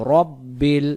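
রব্বিল with a full "rob" and lingering double b; correct recitation. Shown as a demonstration. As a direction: chant রব্বিল in two weighty syllables. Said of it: "উচ্চারণ হবে রব্বিল".

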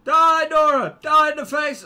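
high, loud shouts of attack; battle yell. Real, not acted. Player attacking Nora in game, loud battle yell. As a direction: yell it at your enemy mid-fight.

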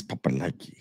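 put-on whispered baby talk; unvoiced whisper of papa likey. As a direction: breathe it out playfully. Whispered catchphrase.

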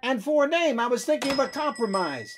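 eager pitch. Acted. Pitching the compromise name.

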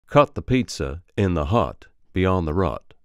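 Alabama accent, uh vowels opened toward aw; Alabama accent drill. Shown as a demonstration. Coach modelling uh as aw, Alabama accent. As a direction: open up cut, hut and rut Southern-style. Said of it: "becomes an aw".

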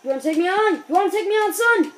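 high, loud challenge to the opponent; mock taunt. Player taunting the hockey opponent in a mock tough voice. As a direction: call him out like a tough guy.

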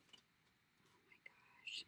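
drawn out, barely audible whisper; slow awed whisper. Real, not acted. Admiring bridal appliques, slow whisper. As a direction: let it slip out slowly in a whisper.

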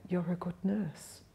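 slow, very soft imitated praise; gentle, weak patient's near-whisper; breathless whisper. Dying miner patting the nurse's arm, breathless whisper. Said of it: "So he whispered".

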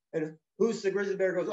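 ordinary delivery, nothing marked

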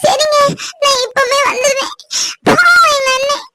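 high-pitched, crying worry; tearful distress. Acted. Sister promising to come to her injured brother, tearful distress. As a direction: agree hurriedly, close to sobbing.

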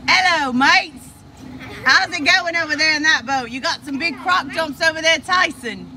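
put-on hamming up an Australian accent for the viewers. Vlogger greets Tyson in the other boat in a hammed-up Australian accent. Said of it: "do my Australia accent for you".

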